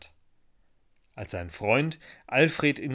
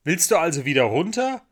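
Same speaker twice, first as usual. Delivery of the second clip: put-on higher, louder boy's question; raised-voice question; calling up; 5 semitones higher, 6 dB louder than usual. A narrator's reading. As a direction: call it up to someone. Michel calls up to Ida.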